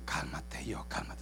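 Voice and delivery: soft whisper, holding yourself back; whispered to oneself. Retold or imitated. Woman calming her own temper, a hushed whisper. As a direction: hush your own anger under your breath. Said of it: "pero le dice a su yo".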